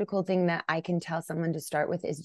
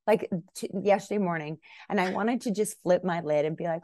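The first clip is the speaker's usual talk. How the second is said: normal talking voice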